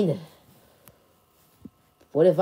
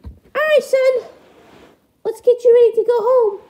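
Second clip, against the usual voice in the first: high, quick falsetto parent voice; very high-pitched puppet parent; duckling's parent, chipper; 7 dB louder than usual. Acted. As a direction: round up your son in a squeaky high voice.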